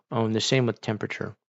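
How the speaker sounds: normal talking voice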